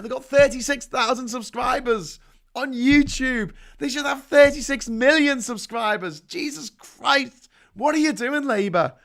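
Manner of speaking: rising to a real high, loud shout; building from a loud rant into a shout at Labour; exasperated outburst. Real, not acted.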